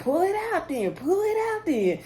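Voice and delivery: high-pitched goading imitation; mock dare. Retold dare during a quarrel.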